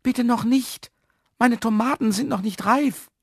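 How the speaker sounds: high, alarmed protest; startled plea. A narrator's reading. Man fears rescue before harvest, high startled plea. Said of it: "Herr Jaromir erschrak".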